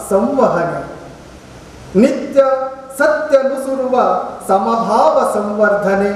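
poem recitation. Acted. Poet reciting an ode to radio to an audience, poem recitation. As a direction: recite it as an ode, pausing after each phrase.

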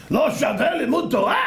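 high, outraged question; scandalized rabbi's retort; indignant challenge. Acted. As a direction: fire it back in mock outrage.

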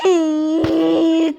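drawn-out wail of loss; real anguished no; anguished cry. Real, not acted. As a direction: cry out as your friend falls. Teammate dies in game.

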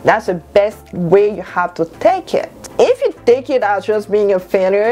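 normal talking voice

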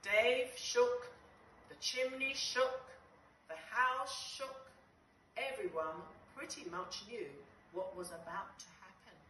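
usual speaking voice

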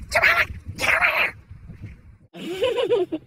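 normal talking voice

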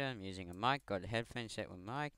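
usual speaking voice

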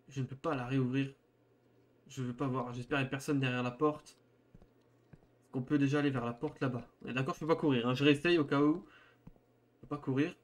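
ordinary talk

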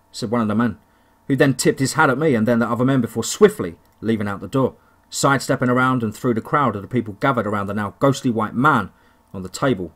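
ordinary delivery, nothing marked

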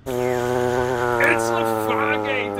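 deep movie announcer. Pretend. Joke rename in deep slow voice.

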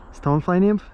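ordinary talk, nothing marked

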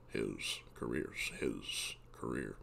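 low slow mock Sam Elliott; Sam Elliott attempt. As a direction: drag the words out low like a cowboy. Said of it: "Sam Elliott reflects on his career".

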